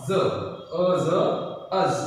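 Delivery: ज, अ ज stretched very slowly, then अज; very slow sounding out. Shown as a demonstration. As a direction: draw out each sound. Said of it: "अ और". (बहुत धीरे-धीरे अ ज जोड़कर अज)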